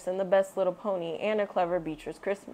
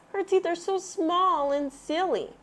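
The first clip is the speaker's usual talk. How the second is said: put-on much higher childlike cub; Baby Bear voice; 10 semitones higher than usual. A narrator's reading. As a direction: tease like a little cub.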